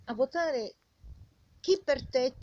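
ordinary talk

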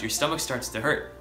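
usual speaking voice

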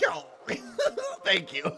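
ordinary talk, nothing marked

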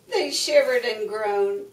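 ordinary delivery, nothing marked

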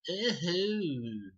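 high, hammed-up ooh to the camera; mock surprised ooh. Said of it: "looks at the camera and goes".

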